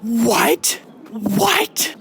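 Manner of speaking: shouted, incredulous what; loud disbelieving what. Real, not acted. A reviewer yelling in disbelief at her book. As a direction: yell it in outrage. Reader reacting to the book's twist.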